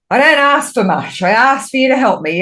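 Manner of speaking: high, firm demand, half pleading; laying down the law. Retold or imitated. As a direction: put your foot down with Spirit in a high voice. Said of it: "laid the law down".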